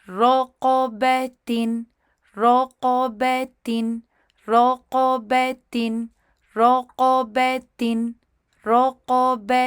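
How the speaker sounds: رقبتن drawn out very slowly, bi with ee sound; slow model reading. Shown as a demonstration. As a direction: repeat رقبتن slowly for learners. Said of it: "ای sound کے ساتھ".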